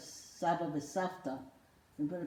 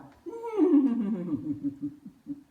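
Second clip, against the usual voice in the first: loud high-pitched puppet giggle; 7 semitones higher, 6 dB louder than usual. Acted. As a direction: squeal with ticklish laughter. Puppet laughing when tickled.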